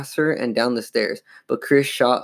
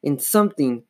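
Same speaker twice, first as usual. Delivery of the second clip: high, urgent declaration fading at the end; raised, frightened insistence; panicking; 6 semitones higher, 3 dB louder than usual. A narrator's reading. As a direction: blurt it in panic, then falter.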